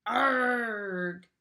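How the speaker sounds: loud annoyed driver's growl; mock angry trucker shout; annoyed yell. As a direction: bark out a frustrated yell. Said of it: "who was annoyed".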